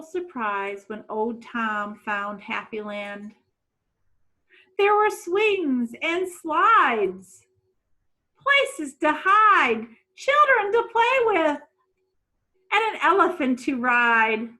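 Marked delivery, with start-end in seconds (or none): 4.8-14.5 excited. A narrator's reading. Excited reading of playground joys.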